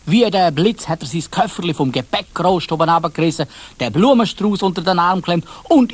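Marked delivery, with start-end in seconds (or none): none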